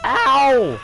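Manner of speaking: sharp yelp of pain; loud ow. Real, not acted. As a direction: yelp when hit. Gets hit in game.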